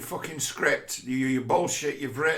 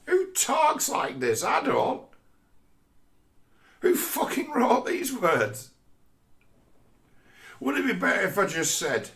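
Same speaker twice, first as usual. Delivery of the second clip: whiny actor; 10 semitones higher than usual. Retold or imitated. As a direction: whine it like a fussy actor. Actor complaining about the script.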